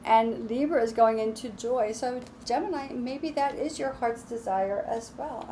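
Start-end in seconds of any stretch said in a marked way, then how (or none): none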